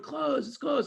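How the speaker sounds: high-pitched insistent shout; imitated crowd yelling. Audience all yelling their answer, insistent. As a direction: keep hollering the correction. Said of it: "They're all yelling".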